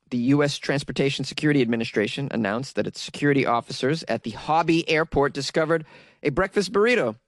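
ordinary talk, nothing marked

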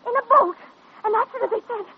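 insist, growing frantic; agitated. Acted. Girl to the doctor, agitated insistence.

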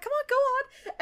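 high, prodding whisper-hiss of encouragement; urging Rarity. Retold or imitated. Rarity urging Fluttershy forward, high and pushy. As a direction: egg her on from the side. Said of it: "Rarity's like, gesture".

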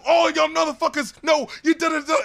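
high, loud mock squabble; heated, garbled impersonation of an argument; imitated angry arguing. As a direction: shout over the others in a mock row. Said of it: "arguing, getting angry at each other".

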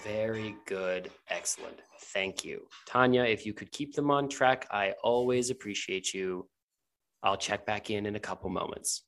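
soft, low-key approving leader; Sylvie. Acted. Sylvie thanking Tanya through the wall, softly. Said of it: "Sylvie says".

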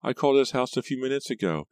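high, plaintive cousin voice; upset caller, pitched well up; thin, upset Pam. A narrator's reading. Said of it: "her voice thin and shaky".

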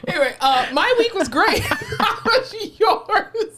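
high giggly talk through laughter; laughing. Real, not acted. Cohost asking about the week, laughing through it. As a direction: try to talk while cracking up.

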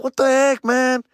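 high-pitched mock protest; acted-out high, wounded grumble; whiny complaint. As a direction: whine it in disbelief. Complains about losing.

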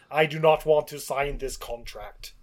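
put-on slow, stiff, deliberate foreign-accented line; a hint of a Slovenian actor's accent, each word spaced; mock Slovenian accent. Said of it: "I don't know, Slovenian or something".

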